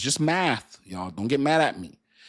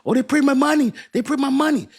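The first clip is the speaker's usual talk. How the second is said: much higher, louder gleeful cheer; mock excitement; 14 semitones higher, 7 dB louder than usual. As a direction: ham up naive joy at printed money. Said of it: "you guys get excited".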